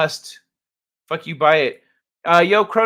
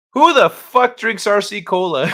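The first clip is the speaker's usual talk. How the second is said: repeating the punchline louder and higher; gleeful quote; 6 semitones higher, 7 dB louder than usual. Retold or imitated. Streamer echoing the voicemail's punchline, loud and gleeful. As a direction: shout the line back with delight. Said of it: "that's fucking glorious".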